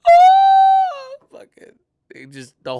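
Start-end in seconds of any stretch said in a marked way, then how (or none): 0.1-1.2 high, loud imitated gasp; mock shocked gasp. Kids in the church crowd, imitated loud gasp.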